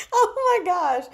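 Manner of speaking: high, loud oh my gosh; delighted squeal. Real, not acted. Thrilled by the commenter's reference, a loud high squeal. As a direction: burst out in delight.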